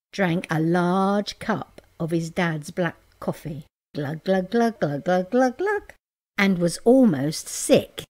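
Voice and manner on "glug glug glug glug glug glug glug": playful glug glug drinking noises; gulping sounds. Acted. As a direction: mimic gulping down a cup. Drinking dad's coffee.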